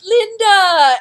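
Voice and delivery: a loud, high call of a name. Retold or imitated. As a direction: call her name across the room. Wally calling to Linda.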